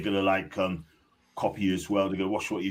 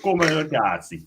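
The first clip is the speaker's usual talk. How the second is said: Italian accent; 6 semitones higher, 3 dB louder than usual. Shown as a demonstration. Showing how the lads say it, in an Italian accent. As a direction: come here, Newcastle said higher and louder in an Italian accent. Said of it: "Or you can say".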